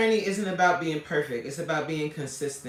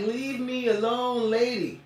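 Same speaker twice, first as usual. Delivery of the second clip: mock scolding; 4 semitones higher than usual. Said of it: "I'm like".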